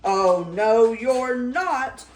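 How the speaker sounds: very loud, booming refusal; roaring troll. Acted. Troll refusing Middle Gruff, loud roar. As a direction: roar it at the goat. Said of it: "roared the troll".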